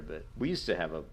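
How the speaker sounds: ordinary delivery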